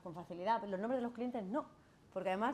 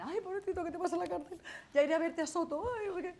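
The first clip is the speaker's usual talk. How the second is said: high, whiny, overly pitying lawyer voice; mock weepy pity; 9 semitones higher than usual. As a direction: pity the client over the top, a much higher mock lament. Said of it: "imagínate que yo me pongo a llorar con él".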